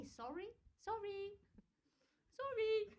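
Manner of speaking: high, singsong, drawn-out apologies; mock polite apologies. British people always apologising, mock politeness. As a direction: imitate over-polite people piping their sorries. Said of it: "You're all very polite".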